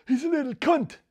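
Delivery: high, loud, snarling dad; dad's angry voice. Retold or imitated. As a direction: spit it out like a raging father. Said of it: "he'd say".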